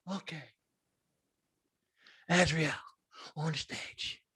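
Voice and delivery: very soft, low, hypnotized murmur; dazed obedient father. Retold or imitated. The father obeying, a low dazed murmur. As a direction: mumble like a man in a trance.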